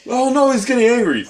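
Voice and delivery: high, loud mock panic; mock alarm. Teasing the angry cohost with mock high alarm. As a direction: squeal it like a scared bystander. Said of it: "mocking cohost's anger".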